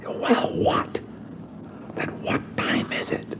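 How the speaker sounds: slow hushed whisper. Retold or imitated. Narrator pressing Howard for the time, whispered. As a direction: demand it quietly through the hush.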